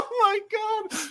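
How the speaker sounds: high, drawn out through laughter; helpless laughter. Real, not acted. Cracking up at friends' crude jokes, helpless laughter. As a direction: gasp it out, nearly crying with laughing.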